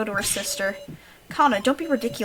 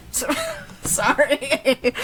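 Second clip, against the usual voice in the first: slow apology through laughter; laughing apology; 4 semitones higher, 4 dB louder, much slower than usual. Real, not acted. Player apologising for the meme voice, laughing. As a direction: giggle out a sorry. Laughs after own joke.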